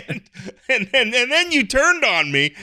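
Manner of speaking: high, laughing mock grievance; laughing complaint. Real, not acted. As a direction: protest through real laughter. Laughing while complaining about the joke.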